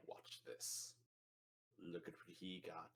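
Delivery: sly whisper. Acted. Azrael whispers from the corner.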